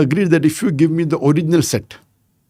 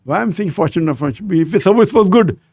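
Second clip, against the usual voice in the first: ordinary delivery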